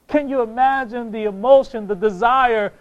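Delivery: normal talking voice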